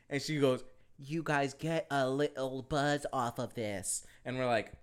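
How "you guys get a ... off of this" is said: slow, softer, word-by-word delivery; slow careful enunciation. Retold or imitated. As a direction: imitate someone making each word understood, very slowly. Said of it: "They don't understand your accent".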